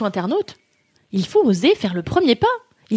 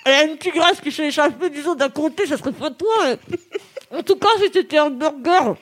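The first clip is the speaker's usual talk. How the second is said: stuffed mouth, garbled words; mouth full; 4 semitones higher than usual. Real, not acted. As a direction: declaim through a full mouth. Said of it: "six marshmallows in mouth".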